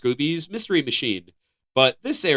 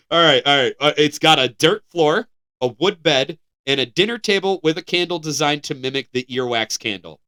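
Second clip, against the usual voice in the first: ordinary delivery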